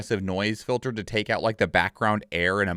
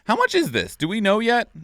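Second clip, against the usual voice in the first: eager; 10 semitones higher, 3 dB louder than usual. Real, not acted. Eager to buy the game.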